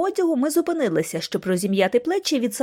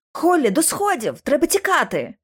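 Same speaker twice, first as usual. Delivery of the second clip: raised, urgent call; urgent command; 5 semitones higher than usual. A narrator's reading. Lucy tells Holly to flee, urgent. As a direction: order the escape with raised pitch.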